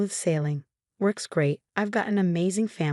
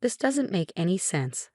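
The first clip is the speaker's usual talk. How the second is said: ordinary delivery, nothing marked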